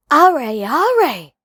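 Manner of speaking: high, loud pitying cry; village women exclaiming. Acted. Women at the gate exclaiming over Mowgli, high and loud. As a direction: cry out in sympathy. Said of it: "said two or three women together".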